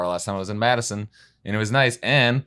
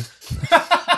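high burst of laughter; loud laugh; 4 dB louder than usual. Real, not acted. Laugh at liquor reveal.

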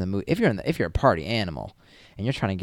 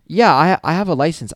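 loud, insistent reassurance, pitched higher; emphatic yes; 8 semitones higher, 10 dB louder than usual. Retold or imitated. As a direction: punch out that yes like you're proving yourself. Insisting to confused guard.

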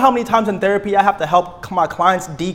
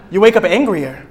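ordinary talk

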